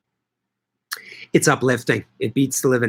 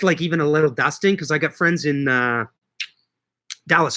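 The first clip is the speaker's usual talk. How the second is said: ordinary delivery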